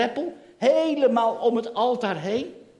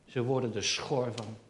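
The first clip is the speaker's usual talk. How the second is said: usual speaking voice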